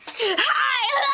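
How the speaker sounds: high, laughing shouted hello; mock an overloud, over-excited greeting; really loud greeting. Contestant greeting the interviewer, really loud. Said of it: "You're really loud".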